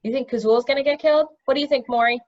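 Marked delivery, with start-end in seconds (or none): none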